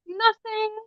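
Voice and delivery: put-on small high boy's voice, soft; a shy four-year-old, quiet and high; little boy. Mom voicing her son answering the teacher, small and soft. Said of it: "And he goes".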